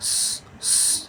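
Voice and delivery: স- as a long unvoiced hiss with no voice. Shown as a demonstration. Teacher shows students the sound স-, a sharp unvoiced hiss. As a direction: hiss স- out through the teeth like hot oil. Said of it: "এভাবে আওয়াজ হবে".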